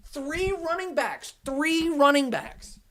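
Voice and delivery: high, slow, drawn-out disbelief; aghast, stretching each word; incredulous. Real, not acted. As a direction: repeat it slowly in high-pitched dismay. Incredulous over the pick mid-rant.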